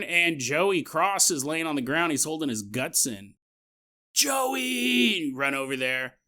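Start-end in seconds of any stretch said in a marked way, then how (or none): 4.1-5.3 calling out. Retold or imitated. Calling to fallen friend.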